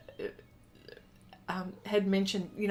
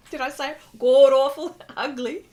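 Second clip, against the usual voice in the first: laughing, higher and louder; amused disbelief; 4 semitones higher, 9 dB louder than usual. Real, not acted. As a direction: ask it through a burst of laughter. Cracks up at own words.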